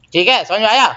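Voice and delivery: ordinary talk, nothing marked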